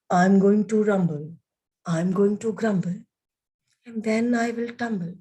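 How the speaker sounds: Fluffy con voz más grave, anunciando su destino. Acted. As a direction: habla como una nube grande y tranquila. Fluffy voiced in low voice.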